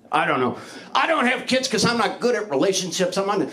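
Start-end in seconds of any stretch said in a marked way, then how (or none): none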